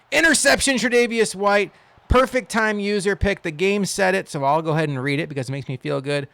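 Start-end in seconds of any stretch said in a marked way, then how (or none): 0.1-4.3 high, loud burst of celebration; excited interception call. Real, not acted. Gamer announcing his defender's interception, thrilled. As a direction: shout the big play, then ease down slightly.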